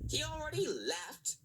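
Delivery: high, surprised cry; shocked he already left; cried out. A narrator's reading. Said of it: "cried Mister Power".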